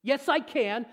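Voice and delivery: put-on high, loud childish insistence; stubborn kid answering back; a child refusing to take no; like a little kid. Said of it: "Just like a little kid".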